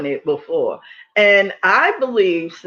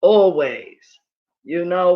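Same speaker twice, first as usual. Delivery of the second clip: ordinary talk, nothing marked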